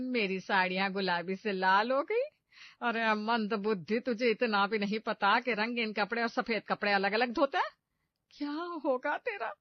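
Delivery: loud, shrieking scold from a furious mother-in-law. Acted. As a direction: tear into the clumsy bride. Mother-in-law scolds over ruined laundry.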